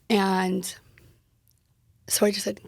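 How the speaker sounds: usual speaking voice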